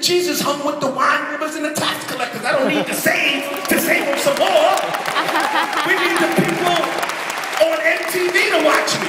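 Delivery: high, eager, half-laughing pitch to Russell; excited pleading. Retold or imitated. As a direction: sell your brother on the idea. Arguing with brother for MTV.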